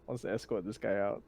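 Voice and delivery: normal talking voice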